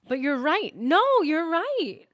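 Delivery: high, loud, drawn-out concession; dismayed realization. Real, not acted. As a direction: concede it in a rising wail. Dismayed realization the other is right.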